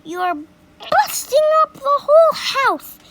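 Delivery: high, loud protest; boy protesting. A narrator's reading. George protesting that Grandma is busting the house. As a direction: cry out in protest.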